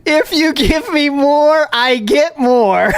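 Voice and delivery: high, slow, spelled out; slow, high, patronising; slow mock explanation. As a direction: talk them through it like children. Explaining tip logic mockingly.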